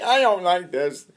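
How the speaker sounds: usual speaking voice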